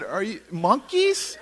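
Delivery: high, slow questioning disbelief; incredulous. Retold or imitated. Landlord questioning the monkey story, incredulous. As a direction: act out a stunned landlord double-checking.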